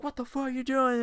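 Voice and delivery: high, put-on angry driver voice; imitating the angry driver. Driver confronting the narrator after the crash, imitated angry.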